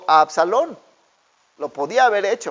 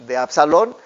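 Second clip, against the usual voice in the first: ordinary talk, nothing marked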